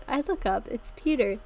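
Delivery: ordinary delivery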